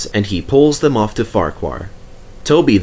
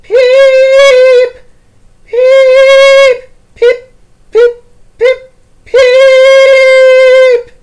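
high, very loud ghostly peeps; Percy pretends to be a ghost, shrieking whistle; 14 dB louder, much slower than usual. A narrator's reading. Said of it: "he shrieked".

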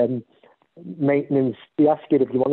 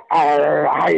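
a hammed-up pirate growl; pirate arr. Playing a pirate for the visiting kids, a hammed-up pirate call. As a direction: give it the full pirate arr. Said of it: "I was giving it all".

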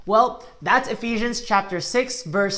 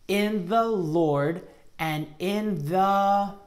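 drawn-out, halting verse reading; very slow recitation; 3 dB quieter, much slower than usual. Shown as a demonstration. Teacher slowly reciting so kids fill gaps. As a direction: stretch every word for the kids to join. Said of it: "Let's try saying it again".

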